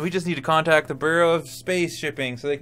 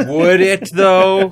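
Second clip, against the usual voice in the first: loud mock doubt; 5 semitones higher, 10 dB louder, much slower than usual.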